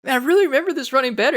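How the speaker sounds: high, loud and fast; excited recall. Retold or imitated. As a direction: blurt it out all worked up. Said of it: "I was like".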